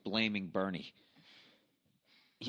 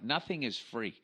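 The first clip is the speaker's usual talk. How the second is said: ordinary talk, nothing marked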